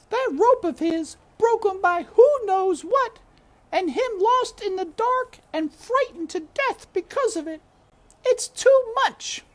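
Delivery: very high, loud cook rising to despair; cook's wail swelling on the repeat; distraught cook building. A narrator's reading. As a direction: push the repeat further into grief. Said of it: "shouted Cook".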